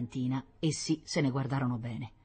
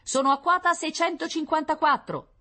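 high-pitched, formal reporting voice; scout's radio report; 13 semitones higher, 4 dB louder than usual. Acted. Scout reporting his altitude to the general, high and formal. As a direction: report figures dutifully in a high voice.

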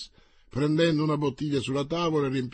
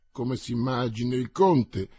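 plain reading voice

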